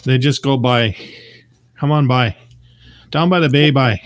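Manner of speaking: imitating a Newfoundland accent; mock Newfie lilt on every "by"; playful Newfoundland accent impression. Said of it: "in Newfoundland".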